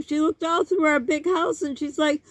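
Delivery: usual speaking voice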